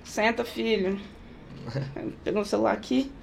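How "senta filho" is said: barely bothered, soft mother's telling-off; mother scolding her son without looking up from her phone, soft; quiet. Retold or imitated. Mother half-heartedly scolding the kid.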